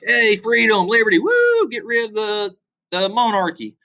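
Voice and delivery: cheering Southerners. Retold or imitated. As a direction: ham it up like a giddy revolutionary fan. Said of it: "They was like".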